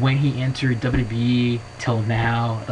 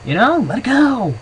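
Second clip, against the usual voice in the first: exasperated rant; 13 semitones higher than usual. Real, not acted. Telling fans to let the old gimmick go, exasperated. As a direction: throw up your hands and tell them to drop it.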